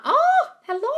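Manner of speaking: high squeal of delight; sudden high-pitched hello; excited hello. Real, not acted. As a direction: greet them with a bright high squeal. Live stream greeting a viewer.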